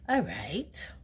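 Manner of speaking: breathless whisper. Retold or imitated. Taking in the doctor's news, whispered. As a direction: whisper it to yourself, stunned.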